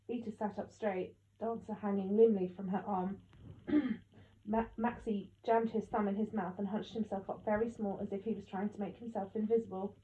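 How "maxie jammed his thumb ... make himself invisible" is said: ordinary delivery, nothing marked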